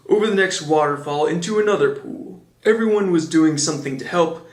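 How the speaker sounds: ordinary delivery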